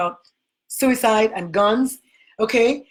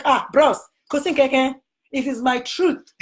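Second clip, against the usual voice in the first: Nigerian Pidgin reply; 2 semitones higher than usual. Retold or imitated. She answers the man in mild Nigerian Pidgin. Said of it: "I was like".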